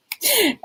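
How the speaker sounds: short high-pitched laugh. Real, not acted. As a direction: let out a quick, high laugh. Laughs after joking aside.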